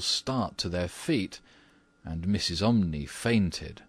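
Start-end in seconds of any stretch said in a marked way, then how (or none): none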